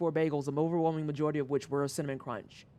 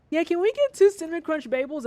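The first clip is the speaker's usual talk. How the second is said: high, bright mock customer voice, a bit louder; chirpy customer order pitched way up; 11 semitones higher, 5 dB louder than usual. Customers at the counter ordering bagels, high mock customer voice. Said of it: "where people were like".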